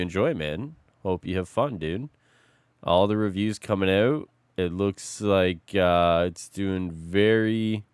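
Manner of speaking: ordinary talk, nothing marked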